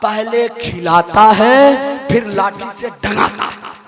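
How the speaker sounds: hushed, low confiding voice; the wife confides a secret to the guest, hushed; secret whisper. Retold or imitated. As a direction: lean in and tell the secret softly. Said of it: "बोला मेरा जो शौहर है ना".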